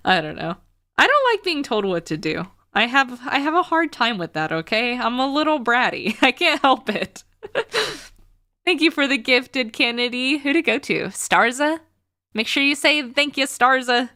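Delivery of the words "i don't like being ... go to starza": ordinary talk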